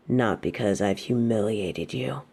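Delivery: normal talking voice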